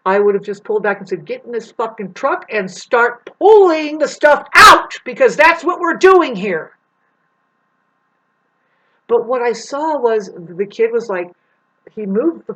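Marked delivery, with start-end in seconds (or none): 1.3-6.8 loud, slow, higher barked command; angry order. Pretend. To a lazy helper, a loud, slow angry order. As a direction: spell out each word like a fed-up boss.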